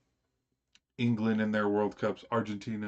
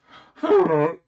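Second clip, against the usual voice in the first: sudden high, loud cracking laugh; 9 semitones higher, 12 dB louder than usual. Real, not acted. Streamer cracks up mid-sentence, high cracking laugh. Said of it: "You hear that voice crack right there?".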